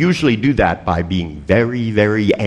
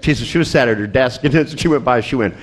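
ordinary delivery

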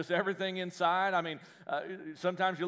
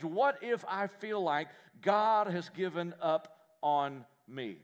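drawn out, every word weighed; slow emphatic question; 3 semitones higher, 3 dB louder, much slower than usual. Shown as a demonstration. Preacher stretching the key question very slowly. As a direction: spell out the fear slowly for the congregation. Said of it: "repeats the key question slowly".

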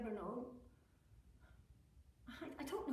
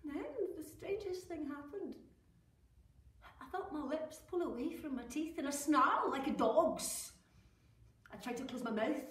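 normal talking voice

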